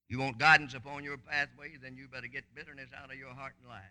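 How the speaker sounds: ordinary delivery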